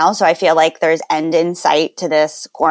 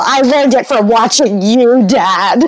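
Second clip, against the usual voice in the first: louder, mock earnest kid from the ad; nineties ad quote; 4 semitones higher, 4 dB louder than usual. Friend quoting the nineties anti-drug commercial, mock earnest. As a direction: recite it like the old PSA. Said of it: "like the nineties commercial".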